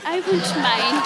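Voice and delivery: slow coy tease; flirty. Acted. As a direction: drag it out teasingly. Flirty line to director.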